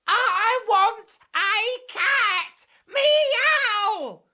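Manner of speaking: slow, high, loud howl. Acted. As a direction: howl it at the night. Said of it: "she was howling".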